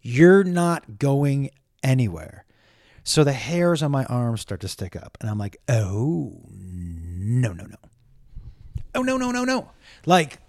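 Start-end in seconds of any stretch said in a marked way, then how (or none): 0.0-2.5 slowly quoted, word by word; slow, deliberate quote. Retold or imitated. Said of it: "nowhere on there does it say". 5.7-7.8 low, soft dread; quiet, sinking alarm; retold dread. Retold dread as hairs rise. 9.0-9.7 high, small-voiced panic; mock-panicked high murmur; retold panic.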